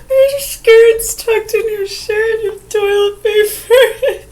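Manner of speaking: high, loud, slow mock lady voice; loud, slowed-down imitation of a lady pointing out a wardrobe slip; polite stranger. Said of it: "some woman came up to her and said".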